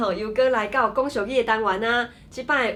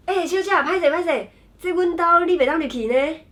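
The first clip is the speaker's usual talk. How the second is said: polite doorkeeper voice; 6 semitones higher, 4 dB louder than usual. Acted. Example skit, stopping a stranger.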